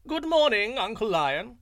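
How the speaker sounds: high, loud cheery greeting; calling out. A narrator's reading. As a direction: call up brightly from the cliff. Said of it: "greeted him by calling out".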